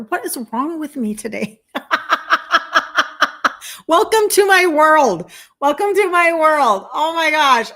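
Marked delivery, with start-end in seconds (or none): 1.7-3.8 high run of pulsing laughter; helpless staccato laughing fit; real laugh. Real, not acted. Laughs at her own emotion. 3.9-7.7 high, loud outburst; real loud, high-pitched exclaiming; overcome exclamation. Real, not acted. Exclaims, overcome after laughing.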